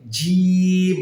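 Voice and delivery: "জিম" held long on one level note, tongue middle to palate; drawn-out jim. Shown as a demonstration. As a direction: stretch the vowel of "জিম", then close on the m. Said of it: "উপরের তালুর সঙ্গে লাগবে". ("জিম" জিহ্বার মাঝখান তালুতে লাগিয়ে লম্বা টেনে)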